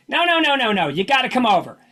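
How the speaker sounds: high, pushy no no no, lightly imitated; lightly voiced insistent friend refusing a no; insistent mock Freddie. Retelling Freddie insisting Bruce come over, high pushy mock voice.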